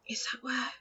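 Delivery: breathy hushed whisper; barely voiced, secretive. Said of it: "whispered Professor McGonagall".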